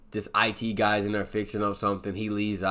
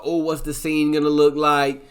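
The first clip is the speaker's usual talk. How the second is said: lifted, louder eager host voice; eager chatter; 5 semitones higher, 6 dB louder than usual. Retold or imitated. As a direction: hype up the planning talk. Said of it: "they're talking about".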